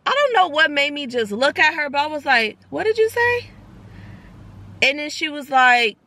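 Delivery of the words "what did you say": 놀라서 되묻는 높은 목소리; stunned question. Retold or imitated. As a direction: caught off guard, asking high. Retold stunned question to waitress.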